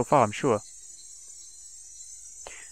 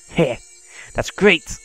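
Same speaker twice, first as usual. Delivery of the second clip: mad laughter; 3 semitones higher, much slower than usual. Acted. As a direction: cackle the praise like a madwoman. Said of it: "my mad laughter".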